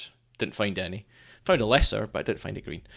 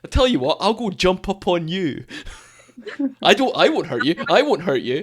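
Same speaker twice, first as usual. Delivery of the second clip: high, loud teasing with laughter; laughing mock threat; 7 semitones higher, 6 dB louder than usual. Joking about jumping on dog owners, loud and laughing. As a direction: tease through laughs.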